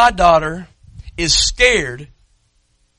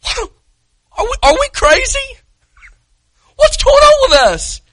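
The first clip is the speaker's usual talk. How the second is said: full-force preaching; 8 semitones higher than usual. Acted. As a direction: rail against the congregation from the pulpit.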